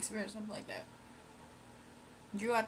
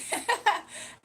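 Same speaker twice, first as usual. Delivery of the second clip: high laugh; 11 semitones higher, 6 dB louder than usual. Real, not acted. Laughing at nickname.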